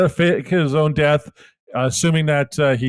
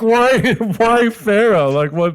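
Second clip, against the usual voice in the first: mock baffled girlfriend; 6 semitones higher, slower than usual. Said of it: "she was like".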